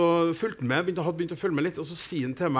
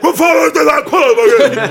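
higher and louder shout with a laugh; imitating his own megaphone shout; outraged yell; 11 semitones higher, 13 dB louder than usual. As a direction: bark at the early leaver. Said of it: "jeg står i megafonmannen og føler meg".

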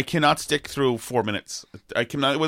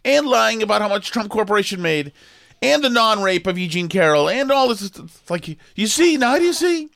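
high, sarcastic lecturing voice, much higher; mocking the left; 8 semitones higher than usual. Mocking the left's case against Trump, high and sarcastic. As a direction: talk down to them like a smug scold.

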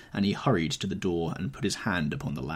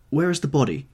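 higher and louder urgent exclamation; exclaimed; 7 semitones higher, 7 dB louder than usual. Acted. Dorian to the gamekeeper, urgently, an excited higher exclamation. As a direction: demand it in a rush of hope. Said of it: "he exclaimed".

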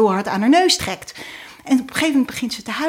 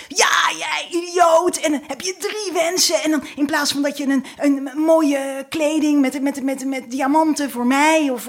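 rip into him like an outraged wife; angry shouting; 5 semitones higher than usual. Acted. Wife rants at husband about the wasted wishes, high furious shouting. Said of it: "te schreeuwen en te voeteren".

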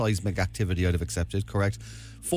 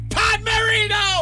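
excited shout; 5 dB louder, slower than usual. Real, not acted. Triumphant quiz reveal.

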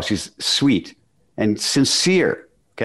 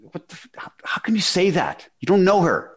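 indignant; 9 semitones higher than usual. Retold or imitated. Indignant retort to judgmental woman.

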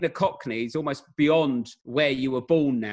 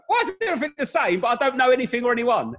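high, louder puzzled complaint; lost in North London, half-comic confusion; mock bafflement; 9 semitones higher, 4 dB louder than usual. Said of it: "you go".

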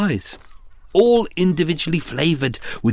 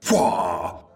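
posh toff; 9 semitones lower than usual. Acted. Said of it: "posh toff exclamation".

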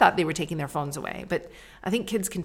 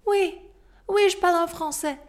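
high, louder, eager French; proud French answer; 13 semitones higher, 6 dB louder than usual. Retold or imitated. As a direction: show off your French.

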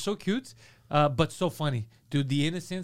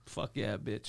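low, hushed imitation of relish; smug triumph; 7 semitones lower, 9 dB quieter, slower than usual. Dater learning she said yes, hushed mock triumph. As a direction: savour it under the breath, mock cool.

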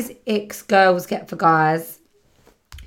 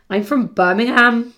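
higher, louder put-on Brummie; Birmingham accent; 5 semitones higher, 5 dB louder than usual. Shown as a demonstration. Podcaster showing listeners an uncertain attempt at Birmingham accent. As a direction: exaggerate a Birmingham accent, pitched up and punched out. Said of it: "I always talk like I'm from Birmingham".

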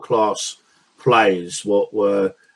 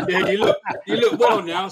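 higher-pitched, talking through laughter; laughing; 10 semitones higher than usual. Real, not acted. Friend teasing the caller about how he looks, laughing. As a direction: say it through a real chuckle.

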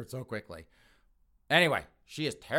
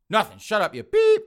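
high, very loud cut-off; angry shout; 10 semitones higher, 12 dB louder than usual. Acted. Son silencing his girlfriend's question, angry shout. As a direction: shout someone down in a panic to hush them.

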